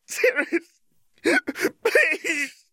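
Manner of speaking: high, thin cry for mercy; desperate pleading. A narrator's reading. Tortured woman pleading with Sirius, desperate begging. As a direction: beg in a high-pitched voice. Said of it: "she pleaded".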